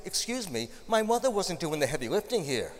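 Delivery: mock indignant. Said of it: "the devilish voice inside me said".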